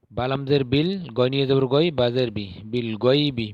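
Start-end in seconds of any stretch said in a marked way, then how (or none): none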